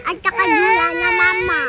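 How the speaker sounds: a loud call over the noise. Real, not acted. Girl calling her mama over the baby's crying, loud.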